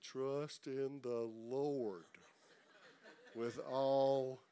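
each word given time, very slow; slow reading. Shown as a demonstration. Pastor modeling slow scripture reading, very slow. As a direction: read it like savoring every word. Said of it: "slow down when you read the word".

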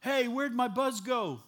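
loud, high, drawn-out holler; hammed-up drunk crying out in dismay; suddenly sobered drunk. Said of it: "gets up off the floor and goes".

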